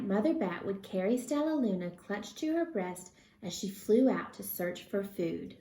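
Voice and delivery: plain reading voice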